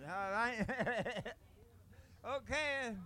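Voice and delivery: ordinary talk, nothing marked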